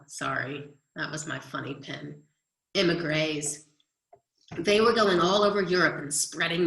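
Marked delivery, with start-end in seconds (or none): 2.7-3.6 Immigrés said the French way, ending "grays"; French pronunciation. Shown as a demonstration. Teacher to students showing the French word, Immigrés ending in "grays". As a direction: say Immigrés with the last syllable like A, as in "grays". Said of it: "over an E is pronounced like A".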